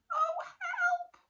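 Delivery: very high, loud squeal; panicked tiny monster. Acted. Tiny monster as the plant crashes, high panicked squeal. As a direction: squeal in alarm.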